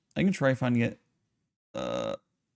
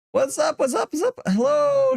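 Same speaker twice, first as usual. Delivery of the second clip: high excited what's up, climbing each time; excited greeting; 11 semitones higher than usual. Real, not acted. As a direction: greet the viewer, each one higher and brighter. Greeting viewer, rising excitement.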